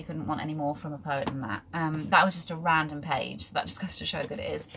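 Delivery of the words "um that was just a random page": normal talking voice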